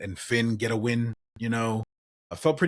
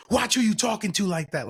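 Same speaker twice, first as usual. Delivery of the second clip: tough warning; 10 semitones higher, 3 dB louder than usual. Retold or imitated. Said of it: "He's like".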